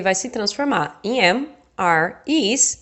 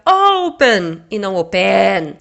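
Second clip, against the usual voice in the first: "open" stressed on O, then wrongly on the end, slowly; teacher shows which syllable of "open" you would shout, slowed; stress contrast; 4 semitones lower, 5 dB louder, slower than usual. Shown as a demonstration. Teacher contrasting stress on open, slow and emphatic. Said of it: "Se você fosse gritar esse verbo".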